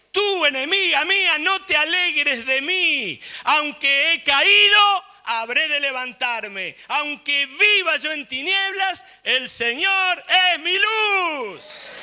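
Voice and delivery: high, very loud, drawn-out defiance; defiant proclamation. Retold or imitated. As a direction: shout down the enemy with full conviction. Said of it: "con el dedo señala y dice".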